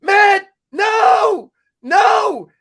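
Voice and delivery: high, loud, drawn-out holler; retold crowd yelling. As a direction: holler it like a whole crowd screaming at a player. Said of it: "everybody's yelling at him".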